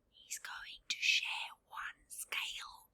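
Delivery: soft unvoiced whisper; whispered aside. Real, not acted. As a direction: breathe it as a secret.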